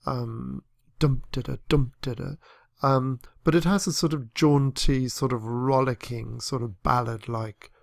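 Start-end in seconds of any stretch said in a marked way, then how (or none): 1.0-2.4 DUM-da-da, the first beat stressed each time; dactyl beat. Shown as a demonstration. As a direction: tap out dum-da-da, dum-da-da, stressed then two light. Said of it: "with some dactyls here and there".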